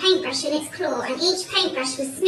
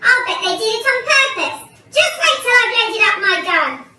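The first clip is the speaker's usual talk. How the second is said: loud, raised vengeful threat; angry yell; 6 semitones higher, 8 dB louder than usual. A narrator's reading. Mister Twit vowing revenge with his gun, angry yell. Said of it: "yelled Mister Twit".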